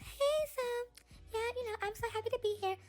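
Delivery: high, soft, sugary mock interview voice; prissy soft-spoken starlet, mocking; mock soft sweet voice. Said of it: "this whole, like, soft".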